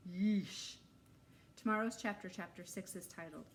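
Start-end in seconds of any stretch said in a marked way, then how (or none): 0.1-0.8 louder grossed-out yeesh; yeesh shudder. Acted. Shuddering exclamation.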